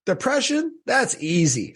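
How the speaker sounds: high-pitched mock breezy reply; dismissive woman, mimicked. Woman waving away his depression story, mock breezy. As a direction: mimic her brushing it off in a high voice. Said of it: "And she goes".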